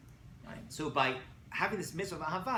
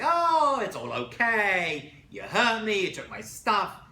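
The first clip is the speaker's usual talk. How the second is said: loud, high, slow sing-song it's all fine; overdone it's-all-okay; mock breezy brush-off; 5 semitones higher, 10 dB louder, much slower than usual. Shown as a demonstration. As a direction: gush it away in a big fake-cheerful voice. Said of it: "You hear the difference?".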